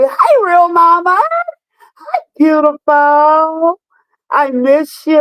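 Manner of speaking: high, drawn-out, singsong hello; gushing, slow, high-pitched affection; delighted greeting. Real, not acted. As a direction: stretch the words out high to greet a dear friend. Greeting a viewer who joins the live.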